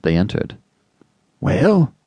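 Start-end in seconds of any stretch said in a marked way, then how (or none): 1.4-1.9 high, rising anxious query; gasped question. A narrator's reading. Anxious father entering the crying room, gasped question. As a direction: gasp it upward as a question. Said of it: "gasped Mister Button".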